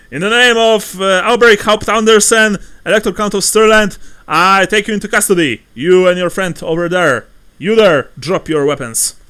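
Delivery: loud, high commanding proclamation; formal arrest. Acted. Soldier arrests the strangers formally.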